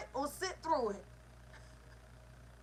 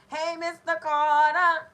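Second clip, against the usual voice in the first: calling out greeting; 2 semitones higher, 10 dB louder than usual. Real, not acted. Host to a viewer joining the stream, calling out. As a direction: call out a big hello.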